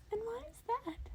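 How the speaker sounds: high, curious creature voice; eager high questioning; curious Nezu. A narrator's reading. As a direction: probe him like a keen little principal.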